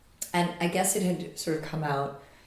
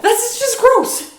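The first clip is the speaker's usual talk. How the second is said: slow, high, loud disgusted whine; grossed-out teen; 9 dB louder, slower than usual. Retold or imitated. Repeating nephew's disgusted line.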